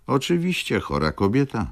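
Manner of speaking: ordinary delivery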